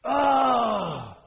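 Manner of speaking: loud, high wordless cry of anger; roaring "Ah!" of outrage; angry bellow. Acted. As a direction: bellow out your anger in one long cry. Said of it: "he bellowed in anger".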